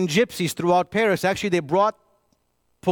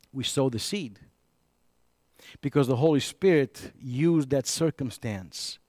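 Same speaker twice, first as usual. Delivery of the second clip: normal talking voice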